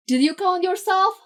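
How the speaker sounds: high, loud call across the van. A narrator's reading. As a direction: shout the question over the noise. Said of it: "someone calls".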